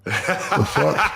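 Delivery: high, laughing disbelief; a jealous, laughing outburst at the missing Shaq shoes; mock outrage. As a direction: squeak out a stunned what. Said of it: "I'm like".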